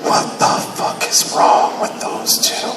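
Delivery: slow whispered disbelief; aghast whisper. Retold or imitated. Ex-wife watching father and son trash-talk, aghast whisper. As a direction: whisper it, soft and drawn out.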